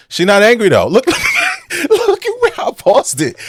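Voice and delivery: high, loud words broken up by laughter; laughing disbelief. Real, not acted. Host mocking a paused clip, laughing loudly. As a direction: crack up while pointing it out.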